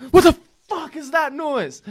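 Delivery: very high, loud screech, dragged out; hammed-up outraged shriek; mock startled yell. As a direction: yell it in exaggerated alarm. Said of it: "I was gonna yell at it".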